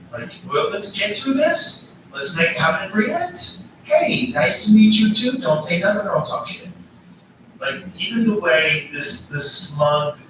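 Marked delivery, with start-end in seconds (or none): 0.5-6.7 higher-pitched mock diplomatic patter; Trump. Mimicking Trump greeting another leader, lightly mocking high-pitched voice. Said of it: "when Trump's talking to someone, it's always like".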